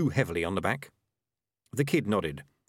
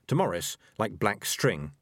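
ordinary delivery, nothing marked

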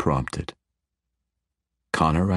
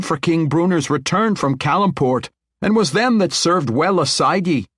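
higher, proud dwarven general; proud dwarf general; 9 semitones higher, 4 dB louder than usual. Acted. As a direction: stand up for your family's honour. Dwarf general claims her lineage.

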